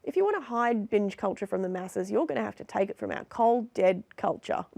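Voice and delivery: normal talking voice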